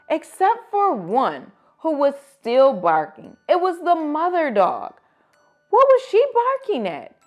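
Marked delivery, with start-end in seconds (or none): none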